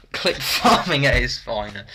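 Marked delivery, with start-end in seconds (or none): none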